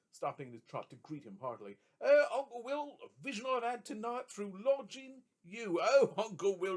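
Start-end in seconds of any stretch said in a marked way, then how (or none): 2.0-6.8 hearty greeting. A narrator's reading. Trotty greets Will heartily.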